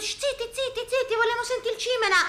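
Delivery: very high, fast annoyed hushing; cinema crowd shushing. Acted. Audience at the village cinema, high fast shushing. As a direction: shush the noisy couple sharply. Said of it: "E tutti".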